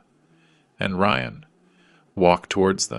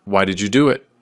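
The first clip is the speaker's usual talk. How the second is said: moderately raised questioning shout; reporter's shout; 5 dB louder, faster than usual. A narrator's reading. Reporter shouting at the family before cameras, mild shout. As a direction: call the question out, a little louder. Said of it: "A reporter shouts".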